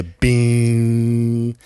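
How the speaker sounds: long, loud ringing "bing" on one held note; imitated ringing. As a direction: imitate ears ringing after a punch, drawn out. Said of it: "you hear the".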